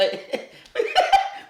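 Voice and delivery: sudden high-pitched laugh; squealing laugh; high burst of laughter. Real, not acted. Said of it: "laughs at wife".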